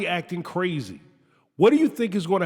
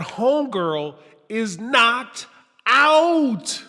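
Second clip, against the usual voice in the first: comic emphasis; 5 semitones higher, 4 dB louder, much slower than usual. Pretend.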